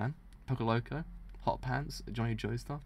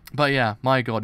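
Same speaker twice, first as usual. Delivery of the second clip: loud enthusiasm; 10 dB louder than usual. Real, not acted. Enthusing about the manga.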